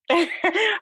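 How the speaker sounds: high breathy laugh through emotion; choked-up little laugh; teary laugh. Real, not acted. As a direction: laugh away the tears. Laugh after tearing up.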